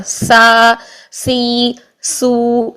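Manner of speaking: long open vowels after a light s in سا، سی، سو; seen syllable drill. Shown as a demonstration. Teacher showing students the letter seen, سا، سی، سو drawn out. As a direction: hold سا، سی، سو long with a thin hissed s. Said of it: "اب اسی طرح زا کو دیکھیے".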